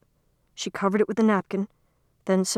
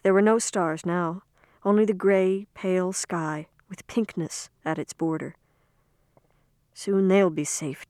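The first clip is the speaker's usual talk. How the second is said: ordinary delivery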